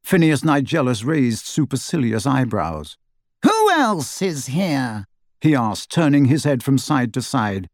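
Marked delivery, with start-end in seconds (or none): 3.4-5.1 high, slow, haughty question; lofty suspicion, pitched up; suspicious snide grandee. A narrator's reading. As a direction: draw out the inquiry imperiously. Snide blindfolded portrait questions the room.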